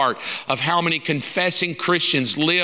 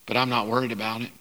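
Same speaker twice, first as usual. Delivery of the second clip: calm reply; 5 semitones lower, 5 dB quieter, faster than usual. Retold or imitated.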